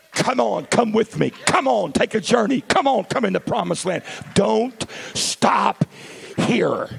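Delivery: high, loud urgent invitation; calling out. Acted. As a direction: call them forward with fervour. God calling them onward.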